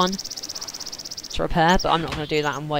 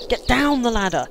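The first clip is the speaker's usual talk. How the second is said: loud, higher angry yell; raging gamer; 6 semitones higher, 4 dB louder than usual. Real, not acted. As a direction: snap at a teammate in the way. Yelling at teammate blocking ladder.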